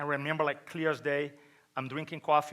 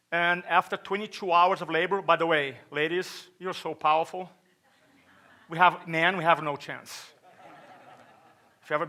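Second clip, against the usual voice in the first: ordinary talk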